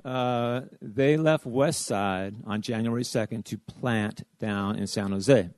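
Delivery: ordinary talk, nothing marked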